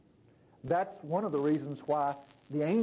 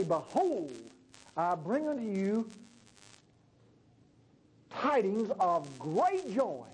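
slow, measured herald's announcement; proclaiming angel; slower than usual. Acted. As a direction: draw out the tidings like a herald. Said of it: "the angels appeared".

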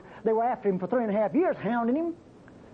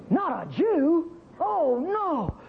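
high, slow, put-on dismay; mock shocked parents; 6 semitones higher, much slower than usual. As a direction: play the horrified parents, drawn out. Voicing shocked Moabite parents.

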